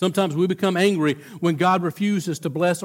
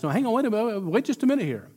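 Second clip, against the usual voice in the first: usual speaking voice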